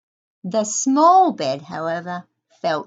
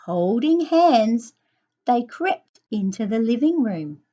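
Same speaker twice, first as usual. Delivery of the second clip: ordinary delivery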